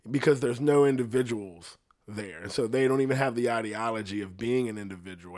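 ordinary talk, nothing marked

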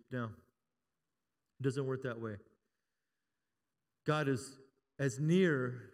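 normal talking voice

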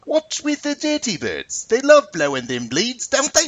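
high, slow put-on Cockney accent; terrible Cockney accent. Shown as a demonstration. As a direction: read it in a hammy Cockney accent. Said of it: "It's my terrible Cockney accent".

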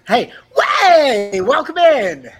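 loud, high, energetic greeting; excited host welcome. Real, not acted. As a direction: welcome them in, full of energy.

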